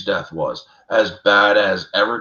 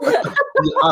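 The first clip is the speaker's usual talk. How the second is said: high laughing "yeah" through the giggle. Real, not acted. Host laughing at his own blunder with his cohost, laughing.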